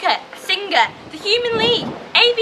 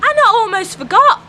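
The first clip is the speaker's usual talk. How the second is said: excited shout; 6 semitones higher, 6 dB louder than usual. Acted. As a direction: announce it like a big finale. Excited announcement ending video.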